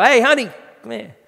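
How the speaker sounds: loud, high call to a partner. Retold or imitated. Husband calls his wife over, calling out. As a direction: raise the voice to summon someone.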